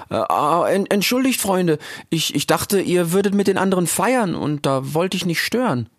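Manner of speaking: put-on high, halting young voice; timid Rix. Rix apologizes. (schüchterner Rix, hoch und stockend)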